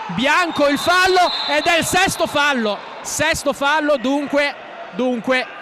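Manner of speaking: high, loud commentary outburst; excited shout. Real, not acted. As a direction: yell out the sixth foul. Sixth foul called out.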